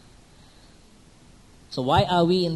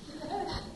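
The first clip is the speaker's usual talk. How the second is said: short laugh; 14 semitones higher, 9 dB quieter than usual. Real, not acted. Mocks husband scolding his wife.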